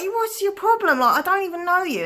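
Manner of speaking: high-pitched, indignant retort; retold indignant challenge. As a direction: re-enact baffled outrage in a high voice. Retold confrontation with attacker.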